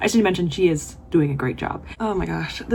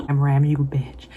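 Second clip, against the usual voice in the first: 1 whispered insult; 3 semitones lower, slower than usual. Real, not acted. Insulting villain.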